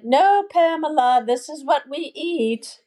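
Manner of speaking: imitating a Lebanese mom's Arabic accent. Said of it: "she would say in her Arabic accent".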